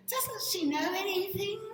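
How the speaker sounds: high, loud mock West Indian critic; indignant West Indian accent. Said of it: "Using the West Indian accent".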